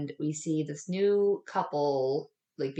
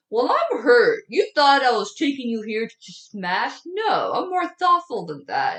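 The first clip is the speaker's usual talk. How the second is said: loud, higher-pitched mock indignation; hammed-up wounded protest; pouting boyfriend; 7 semitones higher, 9 dB louder than usual. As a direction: play him hurt and pouty. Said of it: "a guy pouting".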